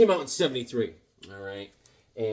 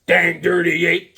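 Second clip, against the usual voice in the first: hammed-up loud dang dirty ape; mock movie growl; 4 semitones higher, 9 dB louder, slower than usual. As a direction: bellow it like the movie hero. Quoting ape movie line.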